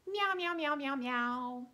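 high sung cat meows; meowing kitty. Shown as a demonstration. Teacher meowing the kitty part of the song, high. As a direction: meow like a little kitten. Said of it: "So we say".

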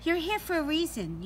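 usual speaking voice

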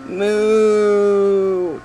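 long, drawn-out moo; playful sustained moo; mock moo. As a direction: hold out a mooing noise. Imitates a cow noise.

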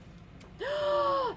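high, long intake of breath; big gasp. A narrator's reading. Storyteller as Toucan falls toward water, shocked gasp. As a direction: gasp in shock before a fall. The moment before the splash.

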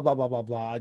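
usual speaking voice

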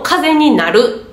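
slowly voiced wrong collocation; mock wrong phrase, slowed; slow wrong example. Shown as a demonstration. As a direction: drag out the odd phrase as a bad example. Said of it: "とか言わんじゃないですか".

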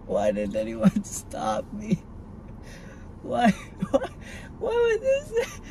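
slow, high crying wail; crying with laughs breaking through; tearful complaint. Real, not acted. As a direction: sob out the question. Crying over her decision.

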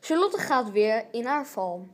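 ordinary talk

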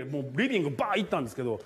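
ordinary talk, nothing marked

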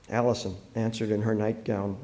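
ordinary delivery, nothing marked

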